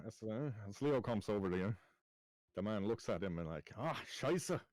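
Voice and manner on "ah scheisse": dismayed exclamation. Acted. Man reacts to seeing Leo.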